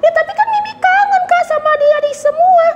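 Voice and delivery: put-on high little girl voice pleading; puppet girl Mimi. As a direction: whine that she missed everyone. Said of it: "Eh, Mimi".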